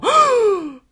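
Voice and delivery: loud, high mock gasp; imitated high gasp of shock. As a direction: gasp sharply like a startled onlooker. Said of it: "I could hear Tim go".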